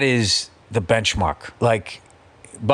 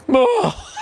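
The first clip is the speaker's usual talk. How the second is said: high-pitched mock wail trailing off; imitated heartbroken cry. Imitating her behind the slammed door, heartbroken wail. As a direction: let out a stricken "oh" that thins away. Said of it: "that heartbreak".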